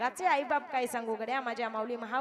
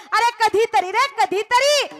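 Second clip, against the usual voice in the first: stretched, much higher and louder call; drawn-out preaching plea; 10 semitones higher, 12 dB louder, slower than usual. Acted. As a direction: plead with the crowd, slowly. Kirtan plea to the crowd. (लांबवून केलेली कळकळीची हाक)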